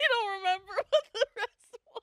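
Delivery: after laughing hard. Real, not acted. Right after a laughing fit.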